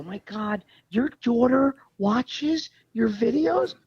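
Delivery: high, slow, pearl-clutching gasp at the question; imitating judgmental people, mock appalled; mock shock. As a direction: mimic scandalized busybodies, dragging it out. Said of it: "people act like".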